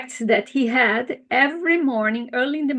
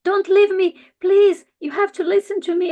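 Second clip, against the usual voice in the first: high, louder pleading fish voice; little fish begging the king, higher and louder; 8 semitones higher, 6 dB louder than usual. Acted. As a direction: beg him not to go, like a tiny fish. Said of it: "and he says".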